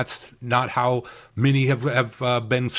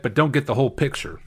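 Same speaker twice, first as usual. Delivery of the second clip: usual speaking voice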